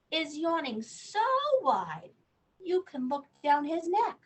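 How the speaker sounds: lively storybook reading; reading to children. Acted. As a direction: read the news of the bug to a child. Reads bedtime book aloud.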